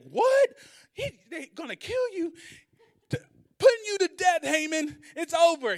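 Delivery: high, roaring outraged shout; furious king. Retold or imitated. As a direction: explode at the betrayal. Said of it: "he went crazy. He's like".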